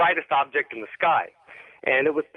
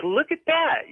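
much higher-pitched exclamation at normal volume; retold amazement; 12 semitones higher, slower than usual. Caller retells seeing lights fly over, amazed exclamation. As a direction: relive pointing out something astonishing.

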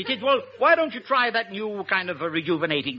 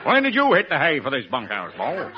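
lightly hammed-up American accent; Bob Hope; 7 semitones lower than usual. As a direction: put on comic American slang talk. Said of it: "my impersonation of Bob Hope talking English".

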